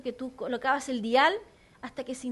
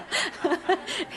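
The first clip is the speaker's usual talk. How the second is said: higher burst of laughter; real laughter; 4 semitones higher than usual. Real, not acted. Preacher joking about knocking knees, bursting into laughter. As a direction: crack up at your own joke.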